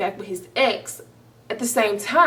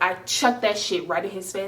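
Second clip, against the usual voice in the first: ordinary delivery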